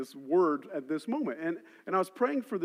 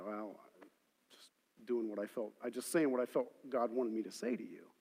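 humble reply; 7 semitones lower, 8 dB quieter than usual. Retold or imitated. Retells his humble reply.

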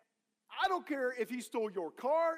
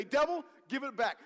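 raised, emphatic command to the devil; meaning it; 5 semitones higher, 5 dB louder than usual. Shown as a demonstration. Preacher showing congregation what to say, meaning it. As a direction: order it back like you mean it. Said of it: "what you gotta do is say".

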